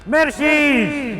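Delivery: high, drawn-out hammy merci; mock French accent. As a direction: stretch it out like a cartoon Frenchman.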